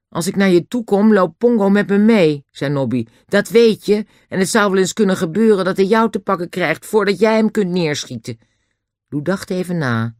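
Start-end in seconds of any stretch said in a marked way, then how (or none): 0.1-2.4 put-on high, loud boy's voice; defiant Nobby. Nobby warns Lou about the chimpanzee, defiant and high-pitched. 3.3-8.4 put-on high, loud boy's voice; defiant Nobby. Nobby warns Lou about the chimpanzee, defiant and high-pitched.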